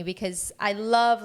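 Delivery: ordinary talk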